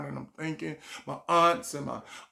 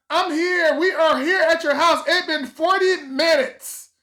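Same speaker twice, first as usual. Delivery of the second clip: much higher, louder impatient mom; 12 semitones higher, 9 dB louder than usual. Retold or imitated. A mom waiting outside scolding her son, much higher and louder. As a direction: voice an annoyed mom on the phone.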